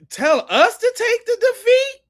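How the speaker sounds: high, loud indignant squawk; outraged disbelief. Real, not acted. Reacting to the clip, squeals in outrage. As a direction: shriek it in disbelief.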